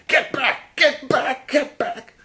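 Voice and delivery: high, loud shriek of get back; imitating a shrieking woman. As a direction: shriek like a crazed neighbor yanking her dog. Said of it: "she's yelling all the time".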